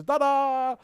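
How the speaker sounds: bright ta-da reveal; mock announcement. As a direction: announce it like a magic trick. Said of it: "I can just say".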